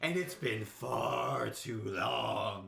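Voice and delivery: very drawn-out villain reveal, hammed up; psychopath alter ego. Storyteller imitating friend's alter ego Jack, slow menacing reveal. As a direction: announce yourself as the sinister other self, stretching the words. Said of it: "Just like a psychopath".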